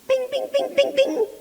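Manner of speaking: very high, loud rapid bings; imitated bings. Dad showing off his aim, rapid high bings. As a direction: tap them out like quick sure shots.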